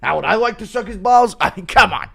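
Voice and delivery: hammed-up affronted protest; indignant reaction. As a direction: repeat it in mock outrage, then dismiss. Acts Dana's indignant reaction.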